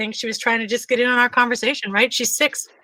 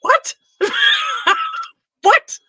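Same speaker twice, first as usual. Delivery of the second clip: real shock, breaking into laughter; stunned disbelief. Real, not acted. Mother stunned by daughter's remark.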